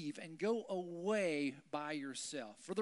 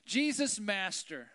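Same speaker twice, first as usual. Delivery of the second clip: high, loud call; 4 semitones higher, 8 dB louder, slower than usual. Retold or imitated. Lepers call to Jesus for help, loud and high. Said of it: "They say".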